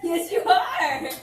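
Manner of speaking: slow, loud, laughing yes you are; laughing insistence. Real, not acted.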